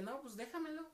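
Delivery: high mock girl voice. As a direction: play her high and coaxing. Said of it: "Y me decía". (novia insistente pidiendo el teléfono, voz aguda burlona)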